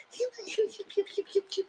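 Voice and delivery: tiny squeaky mocking voice; exaggerated mew-mew pew-pew impression; Sharice. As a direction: squeak softly, very high, in little bursts. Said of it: "she got the Mew Mew Pew Pew voice".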